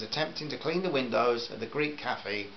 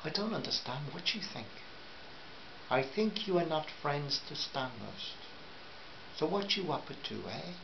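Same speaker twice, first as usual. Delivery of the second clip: put-on softer, probing Greek accent; 2 semitones higher, 8 dB quieter than usual. A narrator's reading. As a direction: ask him quietly what he's up to, in a Greek accent. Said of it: "my Greek accent".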